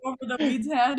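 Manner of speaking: usual speaking voice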